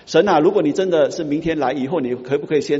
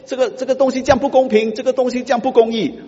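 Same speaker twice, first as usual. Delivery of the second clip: much higher, whining protest; mock complaint; 11 semitones higher, 4 dB louder than usual. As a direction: complain like a wronged believer. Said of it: "我们说". (高声抱怨世界不公)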